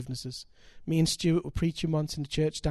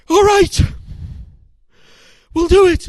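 high, loud breaking cry of consent; emotional outburst; 15 dB louder than usual. Retold or imitated. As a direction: blurt it out as if it tears from him. Husband finally agrees to forgive.

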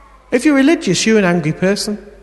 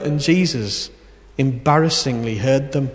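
usual speaking voice

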